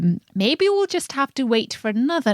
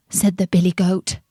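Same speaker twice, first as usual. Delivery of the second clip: usual speaking voice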